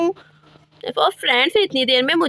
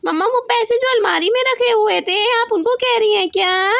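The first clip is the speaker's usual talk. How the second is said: put-on high, eager child voice; high childish voice, excited; excited little girl; 6 semitones higher than usual. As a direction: play the little daughter asking mom. Child voice answers mom.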